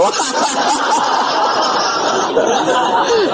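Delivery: very high giggling fit; real laughter up high. Real, not acted. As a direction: crack up completely. Laughing at own story.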